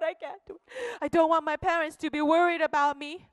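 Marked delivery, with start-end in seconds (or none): 0.0-0.7 much softer, falling away; quiet despair. Acted. John repeating his refusal to himself, almost gone. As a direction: sink into defeat.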